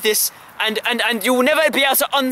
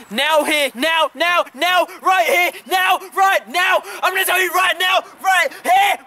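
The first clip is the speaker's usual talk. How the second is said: slow, drawn-out shouting, much higher; yelling; 6 semitones higher, slower than usual. Acted. Vlogger yelling at his viewers to hype the reveal, drawn-out shout. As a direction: stretch each word out and yell it. Mock rant builds to yelling.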